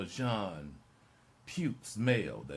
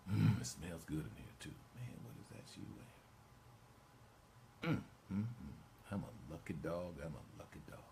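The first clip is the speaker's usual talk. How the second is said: soft, contented musing to oneself; acted private delight, hushed and unhurried; savoring murmur; 6 dB quieter than usual. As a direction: trail down softly as if relishing a lovely smell. Imagined homecoming, savoring it to himself.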